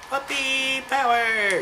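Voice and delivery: high, slow cartoon-style cheer of a catchphrase; quoted catchphrase. Player shouting a show's catchphrase, lightly cartoonish cheer. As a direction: belt it out like a show's rallying cry. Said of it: "You know what that's from?".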